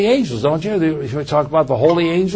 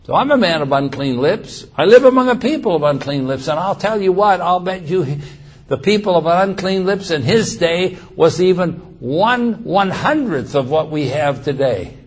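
normal talking voice

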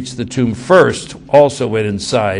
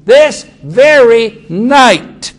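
much higher, louder and very slow on this very night; emphatic repeat; 11 semitones higher, 9 dB louder, much slower than usual. Acted. Jesus warning Peter, the repeat rung out high and loud. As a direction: drag out this very night, hammering each word.

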